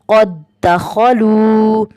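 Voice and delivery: very slow, drawn-out Quranic recitation. Shown as a demonstration. Teacher reading the first example to students, slow drawn recitation. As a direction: stretch every vowel as in tajweed. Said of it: "अगली मिसाल देखते हैं".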